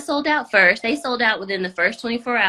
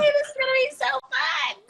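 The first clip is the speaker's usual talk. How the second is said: retold excitement; 14 semitones higher than usual. Host recalls reacting to her team's video, excited. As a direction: re-enact a giddy, high-pitched yay.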